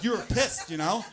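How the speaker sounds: normal talking voice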